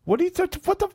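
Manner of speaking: high, loud squeal of disbelief; mock shock. Real, not acted. Catching Sam on YouTube, high mock outrage. Teasing cohost's screen.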